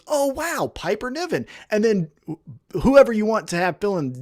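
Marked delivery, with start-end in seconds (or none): 0.1-1.5 a little higher, softer, faux wonder; hammed-up dawning awe; mock amazed. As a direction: play the newcomer suddenly impressed. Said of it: "Jimmy Smith goes".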